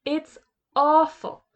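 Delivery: put-on slow, high, loud complaint from a fed-up boy; upset boy. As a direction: drag it out like a worn-out kid. Children fed up with Hamish.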